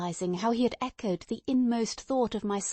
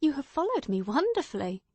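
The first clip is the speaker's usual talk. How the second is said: exclaiming; 5 semitones higher than usual. Acted. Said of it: "I exclaimed".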